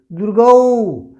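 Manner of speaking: the ऊ in दीर्घऊ held twice as long, slow; long vowel. Shown as a demonstration. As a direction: stretch out the ऊ of दीर्घऊ. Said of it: "इसमें दो गुना ज़्यादा समय". (दीर्घऊ में ऊ को दो गुना लंबा खींचें)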